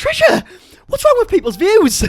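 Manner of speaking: shouted comic indignation; indignant shout. Real, not acted. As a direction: yell her name in mock outrage. Outraged reaction to insult.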